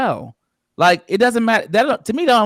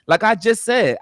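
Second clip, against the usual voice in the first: ordinary delivery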